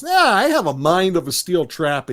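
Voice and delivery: imitated huffy boast; Eric. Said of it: "Eric gets all indignant".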